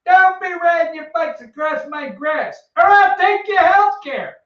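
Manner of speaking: put-on old man yelling at kids on bikes, cranky and high; grumpy old man yelling. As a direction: shake your fist at the kids on your lawn.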